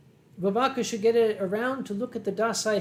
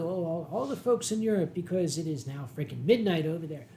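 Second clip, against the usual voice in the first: ordinary talk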